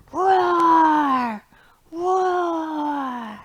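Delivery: big loud high roars; lion roar. Acted. Teacher roaring along in the song, loud roar. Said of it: "you get to roar".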